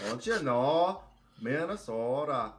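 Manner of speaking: slow Minnesota drawl on "Minnesota"; lightly put-on Minnesota "don't you know"; Minnesota accent. Correcting the co-host, mild mock Minnesota accent. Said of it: "I think that's more Minnes".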